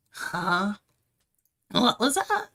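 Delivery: high, loud confused question; startled, ask it sharply; surprised. Acted. Confused reaction, far timbre.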